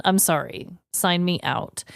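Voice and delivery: ordinary talk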